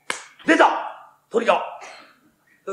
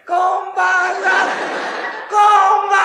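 much higher coy woman's voice; ghost woman's greeting; 12 semitones higher, 5 dB louder, much faster than usual. Acted. Fool imagining a ghost woman calling at his door, high coy voice. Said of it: "この辺から声出して".

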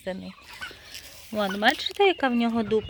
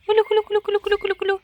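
high sing-song calling to poultry; high repeated call to the turkeys; 10 semitones higher, much faster than usual. Real, not acted. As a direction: call the birds in with a high chant.

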